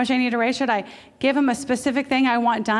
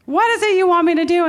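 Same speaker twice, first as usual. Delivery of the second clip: Jesus answering; 5 semitones higher, 6 dB louder than usual. Acted. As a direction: ask them kindly but loud. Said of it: "Jesus answered them back and says".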